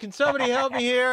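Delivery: high, panicky plea; scared. Acted. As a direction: squeak it out in comic fright. Brother scared by evil voice.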